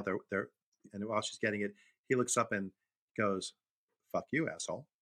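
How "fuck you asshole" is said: mock deadpan child, in an even voice; matter-of-fact, unbothered delivery. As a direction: say it with no expression at all. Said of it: "Just, just deadpan".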